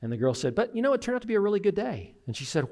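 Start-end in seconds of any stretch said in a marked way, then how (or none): 0.5-2.2 put-on higher, quick girlish retelling; upbeat daughter. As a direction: lightly voice a daughter brightening up. Daughter's upbeat turn in retold story.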